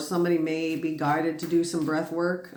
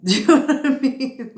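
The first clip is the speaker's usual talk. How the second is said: laughing; 7 semitones higher, 7 dB louder than usual. Real, not acted. Laughing while urging listeners.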